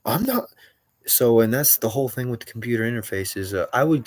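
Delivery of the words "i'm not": playing dumb. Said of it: "they tell me this every day".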